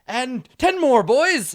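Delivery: high, loud drill-instructor yell; barked exercise count; drill shout. Acted. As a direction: shout it to a squad mid-exercise. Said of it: "basically just shouting at them".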